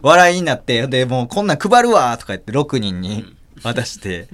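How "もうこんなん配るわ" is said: mock shout. Joking retort in imagined scene.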